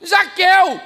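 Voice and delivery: loud, high preacher shout; preaching at full force. Acted. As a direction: thunder it to the crowd.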